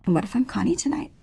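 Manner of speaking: hushed challenge; whisper. Acted. Maris whispers a challenge to Alf. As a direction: breathe the question at him.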